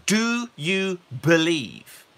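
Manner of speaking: slow, searching "Do you believe?"; Jesus asking. Retold or imitated. Jesus asking the blind men, slow and searching. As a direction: ask it slowly and searchingly. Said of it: "What Jesus said is".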